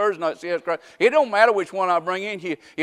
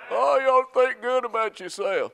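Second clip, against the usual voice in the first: higher, slow, mocking sing-song; mock self-esteem; 6 semitones higher than usual. Preacher mocking the self-esteem teaching, sneering sing-song. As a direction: parody the soothing self-help voice.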